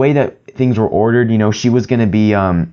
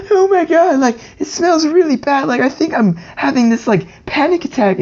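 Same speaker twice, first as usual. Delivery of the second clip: mock panicky teacher in a much higher squeaky voice; freaked-out teacher. Imitating the teacher panicking over the smell in class, high and squeaky. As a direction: ham up the teacher losing it, pitched way up. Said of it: "she started freaking out".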